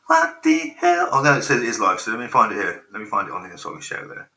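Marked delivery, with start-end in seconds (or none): 0.1-1.1 loud, very high, drawn-out squawk; loud falsetto exasperation; exasperated outburst. Real, not acted. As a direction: cry out slowly in high-pitched disbelief. Exasperated at the stream not working.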